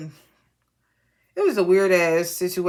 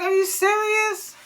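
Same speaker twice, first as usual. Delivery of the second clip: high, slow disbelief; a much higher, drawn-out are you serious; incredulous; 12 semitones higher than usual. Retold or imitated. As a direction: stretch it out in shock. Incredulous reaction to Karen.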